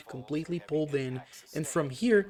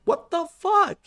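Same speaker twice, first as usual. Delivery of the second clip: much higher, louder what the fuck; shocked outburst; 6 dB louder than usual. Real, not acted. Gamer reacting to skeleton soldiers in the mud, high and loud shock. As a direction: yelp it in sheer disbelief. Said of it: "that's the right response".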